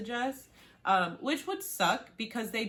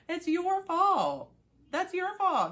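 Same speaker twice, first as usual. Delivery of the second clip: higher, slow, hammed-up blame; mock scolding; 12 semitones higher, 5 dB louder, slower than usual. Viewer scolds Dom, mock angry and slow. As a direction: scold him like it's all his fault. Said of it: "And then I'm like".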